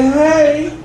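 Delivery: ordinary delivery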